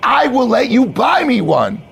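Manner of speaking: high, emphatic bro bonding; hyped-up bro, chummy. Acted. Manager adopts new hire as his boy, chummy bro. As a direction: declare friendship like a pep rally.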